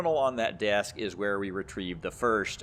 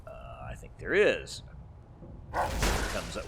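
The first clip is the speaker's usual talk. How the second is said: ordinary talk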